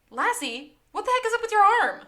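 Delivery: high, loud, fast mock demand; a hammed-up Lassie barking a question. [name] voicing Lassie's demand.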